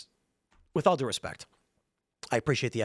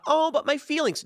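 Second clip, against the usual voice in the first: mock whiny voice; 12 semitones higher than usual. Mocking whiny feminist complaint.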